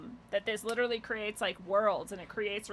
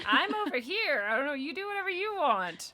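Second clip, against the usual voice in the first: much higher, brushing-off mimicry; mock dismissive teacher; 7 semitones higher, 4 dB louder than usual. Mocking colleagues who disown her, much higher mimicry. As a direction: toss it off high like you couldn't care less. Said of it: "They're like".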